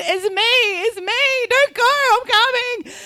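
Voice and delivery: high-pitched retold plea; frantic calling out. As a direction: call out desperately to stop them leaving. Retold yelling to gate agent.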